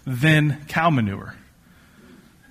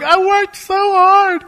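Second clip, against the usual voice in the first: high-pitched, drawn-out hammed-up whine; mock wailing protest; 3 dB louder, slower than usual. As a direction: wail it slowly like a wronged kid, playfully. Imagined protest at being redirected.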